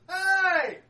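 a loud, high, drawn-out shout to the gatekeeper; calling out. Acted. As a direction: hail the guard from afar, high and loud. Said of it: "walks up to the gate and goes".

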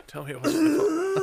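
high, far-off fake sobbing plea; mock ugly crying. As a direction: wail it like a hammed-up ugly cry. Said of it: "It's ugly crying with a Bernie cutout".

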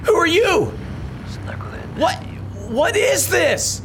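high-pitched, halting demands into the phone; startled bursts, rising to a drawn-out cry; alarmed confusion. Acted. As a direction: demand answers from a baffling caller. Confused demand to whispering caller.

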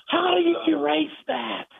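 high, strained, near-crying demand; outraged, teary shout, slowed down; retold yell. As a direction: bark it like a furious coach. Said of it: "yelling at me".

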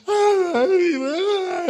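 high, loud mock wail; agonized wail. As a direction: wail wordlessly in acted agony. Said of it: "you just have groanings".

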